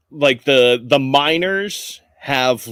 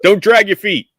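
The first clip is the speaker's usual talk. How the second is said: higher, louder guard instruction; called-out coaching; 6 semitones higher, 5 dB louder than usual. Acted. Guard advises jogging Huxley, called-out coaching. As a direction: call pointers to a runner.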